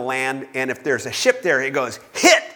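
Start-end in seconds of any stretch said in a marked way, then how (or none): 2.1-2.4 sharp high call of hit; excited call. Retold or imitated. Player calling a hit in Battleship, excited shout.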